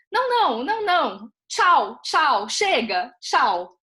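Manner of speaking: put-on child's insistent refusal. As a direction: imitate a child pushing the screen away, loud and high. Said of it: "e com uma entonação, né, que foi muito rara".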